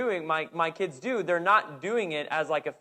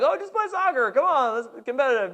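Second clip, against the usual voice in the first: high, bright pleading; coaxing parents; 8 semitones higher, 4 dB louder than usual. Retold or imitated. Parents urging their daughter to play, high and coaxing. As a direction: cheer her on like eager parents. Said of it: "we're like".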